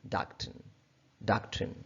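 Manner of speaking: डॉक्ट्रिन with an aa sound, dak-trin; American pronunciation. Shown as a demonstration. Teacher modelling American डॉक्ट्रिन. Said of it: "और American में".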